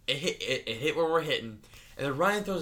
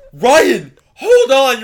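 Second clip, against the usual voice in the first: loud, high retold shout; urgent yell; 11 semitones higher, 16 dB louder, much slower than usual. Teller calling to Ryan in the current, urgent yell. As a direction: yell it to someone slipping away.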